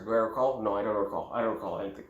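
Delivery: low, soft flat denial; blank students. Retold or imitated. Students with blank stares, low soft denial. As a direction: mumble it, blank. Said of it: "everyone will be just have a blank stare".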